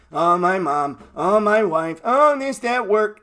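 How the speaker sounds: mocking excuses. Trader mocking people who make excuses, whiny mock voice. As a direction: parrot their lame excuses with scorn.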